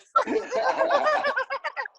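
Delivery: big high-pitched laugh. Real, not acted. Laughing at friend's joke.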